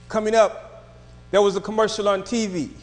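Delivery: normal talking voice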